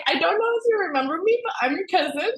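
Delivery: high, quick, giggly self-quote; laughing retelling. Retold or imitated. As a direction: imitate your own awkward message, amused. Retells her awkward first message, laughing.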